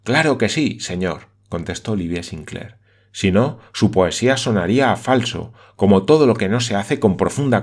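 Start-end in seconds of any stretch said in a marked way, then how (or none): none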